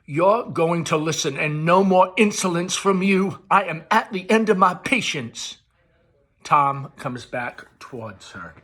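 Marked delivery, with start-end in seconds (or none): 0.0-5.6 high, loud scolding; shrill-edged loud maternal rebuke; angry mother. Acted. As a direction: lay down the law, furious.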